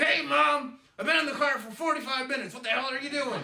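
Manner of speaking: high, strained closed-mouth yell; mock-furious, teeth-clamped scolding. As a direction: yell through clenched teeth so only mom hears. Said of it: "yelling you do with your mouth closed".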